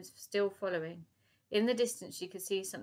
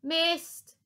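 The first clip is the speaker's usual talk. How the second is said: high, loud gloating shout; taunting call; 10 semitones higher, 9 dB louder than usual. Acted. Professor taunting Lady Buckshot, loud gloat. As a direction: crow it at her. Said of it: "called out the professor".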